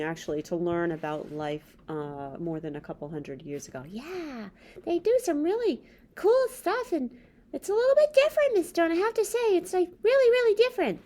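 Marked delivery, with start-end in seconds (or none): 3.9-8.9 high squeaky puppet voice, fast and eager; a chirpy little puppet character. Acted. Puppet Bob's voice again. 8.9-11.0 high squeaky puppet voice, fast and eager; a chirpy little puppet character. Acted. Puppet Bob's voice again.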